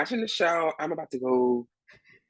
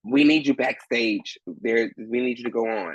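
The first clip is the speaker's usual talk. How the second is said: slow, lower, spelled-out insistence; insistent stage manager; 4 semitones lower, slower than usual. Retold or imitated. Stage manager insisting he must go on, slow. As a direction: drag it out so he finally gets it.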